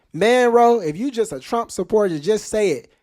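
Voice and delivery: loud, high call-out; bro, just admit it, loudly; exasperated call-out; calling them out. Real, not acted. Calling out Trump critics.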